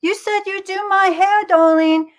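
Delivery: high, far-off wifely whine; imitating Helen. Wife reminding husband to do her hair, high whine. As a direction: plead sweetly but high. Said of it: "I love your Stu Hart impression".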